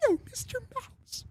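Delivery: very high, soft, meek squeak. Acted. Second cowed band member answers [name], high soft voice. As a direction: echo it meekly in a tiny falsetto. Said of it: "the fucking Jonas Brothers say something".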